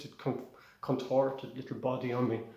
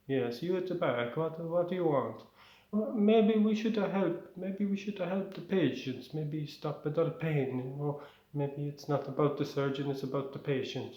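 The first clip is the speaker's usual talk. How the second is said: put-on Italian accent; 3 semitones higher than usual. Student suggests helping the patients to the teacher, Italian accent. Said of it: "I'll do the Italian accent".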